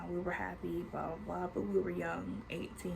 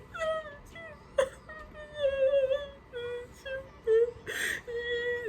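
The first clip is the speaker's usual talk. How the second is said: much higher wailing sob; ugly cry wail. Retold or imitated. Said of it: "like, the ugly cry".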